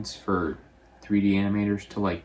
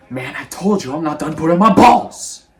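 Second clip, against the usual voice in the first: loud, high, hammed-up angry yell; mad yelling; 7 semitones higher, 13 dB louder than usual. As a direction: build to a big mock-furious shout on the last word. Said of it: "mad, obviously".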